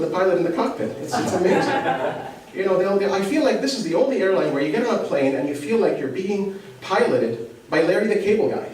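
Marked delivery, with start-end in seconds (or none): none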